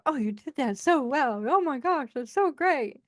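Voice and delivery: a lightly voiced cheerleader gushing; mock cheerleader praise. Friend imitated as the cheerleader she isn't. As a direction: gush over it, half-mocking. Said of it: "she's not a cheerleader either".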